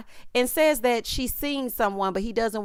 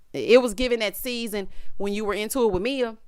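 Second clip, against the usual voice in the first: usual speaking voice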